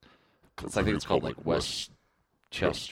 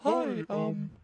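high lady voice; 14 semitones higher than usual. Acted. Prank caller puts on lady voice.

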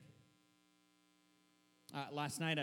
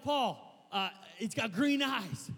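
raised pitch, slow and loud, mock alarm; alarmed report; 5 semitones higher, 6 dB louder, much slower than usual. Preacher reenacts telling Paul about green eyes, mildly alarmed. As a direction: report the sighting in mild acted panic.

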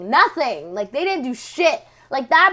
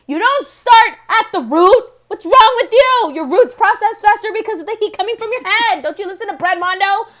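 high, loud, fast scolding; exasperated rant; 6 semitones higher, 6 dB louder than usual. Acted. Ranting at the TV stylist about bleaching roots, exasperated and loud. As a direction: tear into her like a fed-up hairdresser. Scolding the hair dye job.

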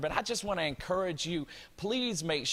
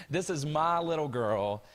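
ordinary delivery

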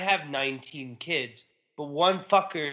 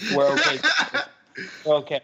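laughing consoling; 4 semitones higher, 4 dB louder, faster than usual. Retold or imitated. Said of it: "He's consoling".

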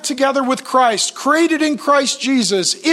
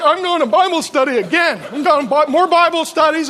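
higher and brighter, bubbling over; eager; 6 semitones higher, 4 dB louder than usual. Retold or imitated. To his puzzled roommate, eagerly excited. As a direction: tell him like you can't wait to go.

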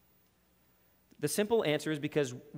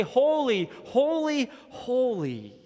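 seraphim praising; 6 semitones higher, slower than usual. Acted. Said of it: "praise God and say".

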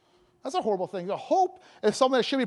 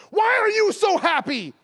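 high, loud, drawn-out exclamation; astonished question; 11 semitones higher, 6 dB louder, slower than usual. Retold or imitated. Young sales associate to watch shopper, astonished shout. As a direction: imitate a young clerk bursting out in amazement.

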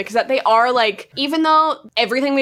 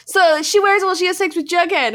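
higher, singled-out tattletale voice; tattling brother; 7 semitones higher than usual. Acted. Brother tattling on his sister to Mom, mock tattling. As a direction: blurt it like a sibling telling on someone.

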